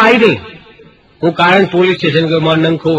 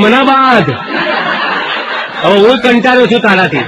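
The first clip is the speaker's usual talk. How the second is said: pitched up, fed-up plea to be tied; hammed-up weary husband; half-laughing, exasperated husband; mock exasperation; 5 semitones higher than usual. Mock exasperated reply to wife.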